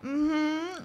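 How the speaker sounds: high moaning hum; high-pitched, moaning agreement; moaned assent. Acted. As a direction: hum a yes through a moan. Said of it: "I moaned".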